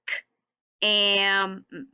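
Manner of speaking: K, AM, MM as separate sounds; teacher segmenting the sounds one by one; sounded out. Shown as a demonstration. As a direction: hold each sound of K, AM, MM apart. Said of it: "Let's tap it out".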